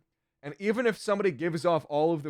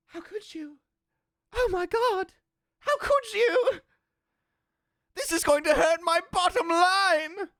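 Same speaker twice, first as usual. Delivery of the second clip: very high squeaky falsetto wail; mock outraged fan; slower than usual. Streamer mimics fans upset by criticism, hammed-up falsetto outrage.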